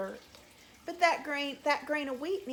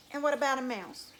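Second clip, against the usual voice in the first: plain reading voice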